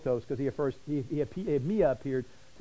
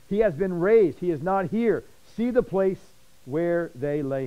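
angel's announcement, louder and higher; proclaiming loudly; 3 semitones higher, 7 dB louder, slower than usual. Acted. Preacher booming the angel's words to the congregation, loud. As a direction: proclaim it like a herald at the tomb.

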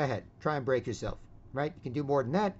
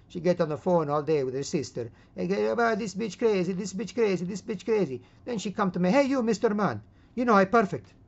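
put-on raised, accented grumbling; old man character; 7 semitones higher, 6 dB louder than usual. Old man complains about his wife's phone gossip, hammed-up accent. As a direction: gripe as a hammed-up old husband.

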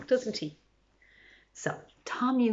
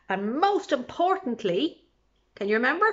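ordinary delivery, nothing marked

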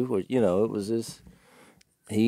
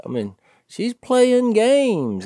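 put-on old man complaining; 11 semitones higher, slower than usual. Old man complaining about girlfriend.

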